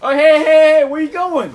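high, loud hey hey hey; loud calling out. Real, not acted. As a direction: shout after a fleeing character. Yelling at game character.

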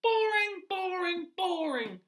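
high loud rat, dropping each time; bored rat. Acted. Teacher voicing the rat mocking brown creatures, loud then fading.

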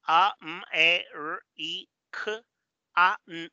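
A, M, E, R, I, K, A, N as separate sounds; sounding out. Shown as a demonstration. Teacher decodes American from colours, sounding out each piece. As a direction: break it into single sounds with short pauses. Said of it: "los colores identificados de los sonidos".

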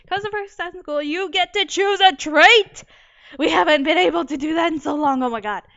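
excited. Real, not acted. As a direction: celebrate the rare chance. Thrilled to choose a trait.

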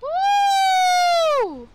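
high, loud whoop of joy; excited whoop. Real, not acted. Gamer whooping at the screen, loud and high. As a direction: let out a big gleeful woo.

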